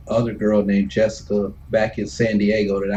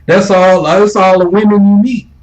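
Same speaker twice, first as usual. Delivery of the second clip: higher, louder emphatic complaint; exasperated outburst; 8 semitones higher, 7 dB louder than usual. Real, not acted. Recalling Navy life, higher and louder, exasperated. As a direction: throw it out loud and high.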